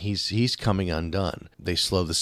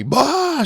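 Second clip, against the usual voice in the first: abrupt high yelp, much higher; mock scream; 6 dB louder than usual. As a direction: parody a pointless battle scream. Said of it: "screaming in the top of his lungs".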